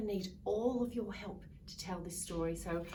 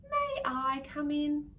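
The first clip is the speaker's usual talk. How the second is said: a touch slower, louder polite ask; polite gecko; 6 semitones higher, 8 dB louder, slower than usual. Acted. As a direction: ask nicely, lightly voiced gecko. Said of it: "Gecko asked politely".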